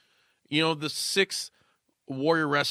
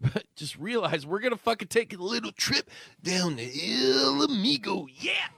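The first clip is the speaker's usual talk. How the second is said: excited hype; 9 semitones higher than usual. Pretend. Hyping the spot.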